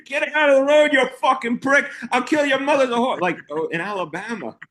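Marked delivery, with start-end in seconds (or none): 0.0-3.2 much higher, louder furious yell; mock road-rage yell. Imitating angry Northeast driver.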